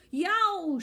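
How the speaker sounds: high, loud sudden yelp; yelp of pain. Acted. Boy yelps as a creature bites his shoe, high and loud. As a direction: yelp as teeth sink in. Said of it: "shouted Stacy de Lacy".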